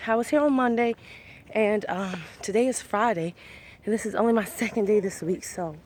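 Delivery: ordinary talk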